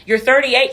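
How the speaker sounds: thirty-eight hit hard and high; You're thirty-eight, emphatic. Real, not acted. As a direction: stress thirty-eight like a scolding. Scolding Charles, emphatic.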